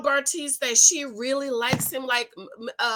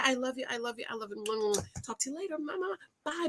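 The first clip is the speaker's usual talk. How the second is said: soft goodbye; 11 dB quieter, faster than usual. Real, not acted. Soft sign-off to viewers.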